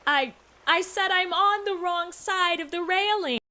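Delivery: high, pushier repeat of the cry; insistent damsel in distress. Pretend. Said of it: "are you pretending to be a damsel in distress".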